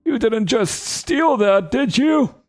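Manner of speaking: put-on high, suspicious old goblin; drawn-out cranky accusation; old guy voice. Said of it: "I'm gonna give him the old guy voice".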